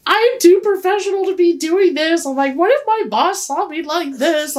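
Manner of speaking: high, hammed-up anxious whine; retold panicky fretting. Lying in brother's care worrying about boss, high fretting. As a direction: act out your own spiralling worry.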